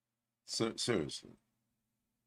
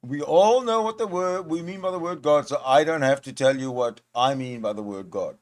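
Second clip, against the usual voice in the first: raised, louder mimicry of an opponent; mocking parroting; 3 semitones higher, 5 dB louder than usual. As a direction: parrot the claim back in mock indignation.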